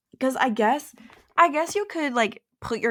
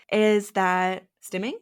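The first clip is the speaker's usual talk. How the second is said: ordinary talk, nothing marked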